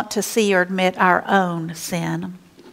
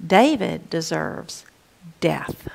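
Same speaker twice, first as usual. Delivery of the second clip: whispered verdict; 3 semitones lower, 5 dB quieter, slower than usual. Acted. David deserves death, hushed verdict.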